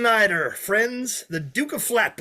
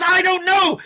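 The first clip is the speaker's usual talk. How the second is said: I don't know shouted high and loud, the director's demo; shouted line reading; 12 semitones higher, 6 dB louder than usual. Shown as a demonstration. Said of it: "Say it".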